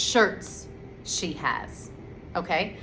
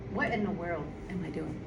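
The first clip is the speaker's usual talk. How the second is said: mutter to self; 4 semitones lower, 10 dB quieter than usual. Real, not acted. Mocks own drawing mistake.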